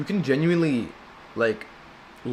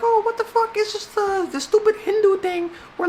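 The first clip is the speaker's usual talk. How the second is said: mocking skeptic.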